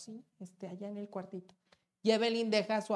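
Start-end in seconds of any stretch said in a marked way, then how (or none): 0.0-1.5 dropped to a near hush; a mock hushed come-on; hushed quote. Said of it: "luego les dice a los chavos".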